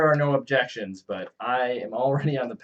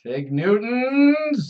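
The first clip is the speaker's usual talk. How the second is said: high, slow, drawn-out cry; mock yell; 9 semitones higher, 4 dB louder, much slower than usual. Player cries out the hated snack's name, drawn-out mock yell.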